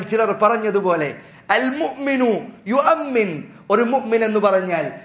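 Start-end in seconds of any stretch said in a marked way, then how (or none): 1.5-3.4 slow, measured Arabic quotation; slow chanted Arabic recitation. Acted. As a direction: recite the Arabic saying slowly, like scripture. Arabic saying of scholars quoted.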